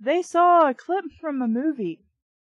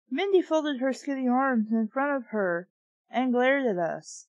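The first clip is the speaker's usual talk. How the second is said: ordinary talk, nothing marked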